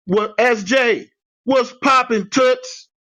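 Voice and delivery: high, slow, hyped-up hello; hyped greeting. Retold or imitated. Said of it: "I would type".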